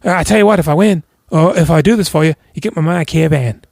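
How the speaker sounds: a lightly voiced Irish boxer offering his caravan; cheeky bargaining. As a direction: sell the bet with a grin. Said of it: "And so he goes".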